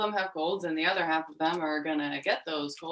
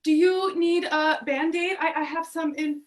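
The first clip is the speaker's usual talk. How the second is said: higher, louder, urgent check on someone hurt; alarmed concern; 9 semitones higher, 4 dB louder than usual. Acted. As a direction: call out in sudden concern. Checks on someone who fell.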